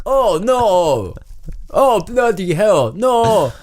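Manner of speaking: high, drawn-out mock wailing; hammed-up accented cries of dismay; stranded man's despair. Stranded man despairs, high comic wailing. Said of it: "convey this message".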